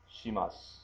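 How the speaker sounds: shimasu with the final u whispered away; softened su. Shown as a demonstration. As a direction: barely voice the su in shimasu. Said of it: "that softened su there".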